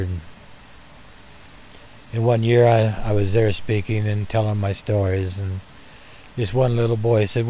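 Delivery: ordinary talk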